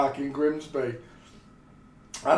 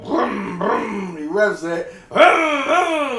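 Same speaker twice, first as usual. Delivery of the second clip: mock car-engine brum, the last two higher and louder; engine revs; 5 semitones higher, 3 dB louder, much slower than usual. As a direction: imitate a revving engine with your mouth. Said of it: "like that".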